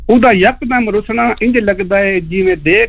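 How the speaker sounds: poem recitation. Shown as a demonstration. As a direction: lift the voice to deliver the couplet. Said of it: "یہ میں چھوٹی سی شاعری لے کے آیا ہوں". (اونچے سُر میں شعر سنانا)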